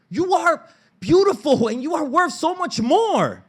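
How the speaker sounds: high, loud pleading tirade; impassioned outburst. Retold or imitated. Fed-up son confronting mom and sister, impassioned outburst. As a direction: snap and pour it out at high pitch, building. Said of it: "not snapped".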